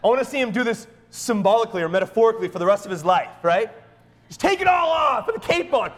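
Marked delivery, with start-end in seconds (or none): 4.3-5.9 high, loud, whooping encouragement; exuberant urging. Acted. Pastor urging his little son to play superhero, exuberant. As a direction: cheer on a tiny superhero, pitch way up.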